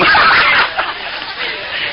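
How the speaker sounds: high unvoiced burst of laughter. Real, not acted. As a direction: laugh out big. Preacher's big laugh.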